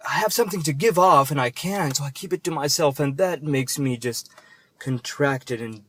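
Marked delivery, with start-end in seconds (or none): none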